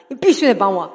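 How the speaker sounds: loud, high, insistent crying out to God; desperate demanding prayer. Retold or imitated. Mother praying for her sick son, loud desperate demand. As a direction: demand it, refusing to let go. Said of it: "掐着耶稣的脖子".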